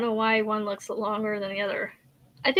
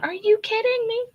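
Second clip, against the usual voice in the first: imitating middle kid; 10 semitones higher than usual. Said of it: "my middle kid's up there going".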